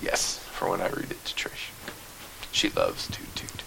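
whisper. Real, not acted. Quiet aside after fairy voice.

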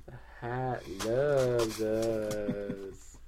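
very slow, stretched-out drone; mind-controlled trance. Pretend. Brainwashed devotee slips in a sponsor, mind-controlled trance. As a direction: stretch every word as if hypnotised. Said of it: "complete and total mind control".

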